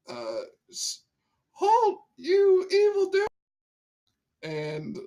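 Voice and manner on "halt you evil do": high-pitched yelled battle call; high shrill heroic cry. Acted. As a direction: shriek it like a tiny hero. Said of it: "I say".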